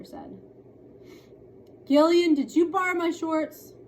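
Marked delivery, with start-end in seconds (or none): 1.9-3.7 accusing sister. Acted.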